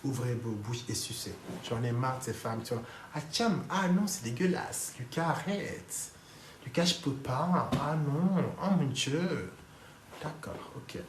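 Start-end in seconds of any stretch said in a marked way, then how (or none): 3.1-9.5 whiny mock protest. Speaker mimics wives refusing sex, whiny mock disgust.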